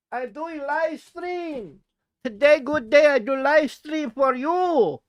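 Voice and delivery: high, pleading lonely streamer in broken English; hammed-up lonely character, pleading; needy put-on voice.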